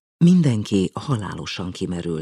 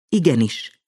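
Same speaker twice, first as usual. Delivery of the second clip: cheerful salute; 5 semitones higher, 4 dB louder than usual. A narrator's reading. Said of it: "vidáman szalutált".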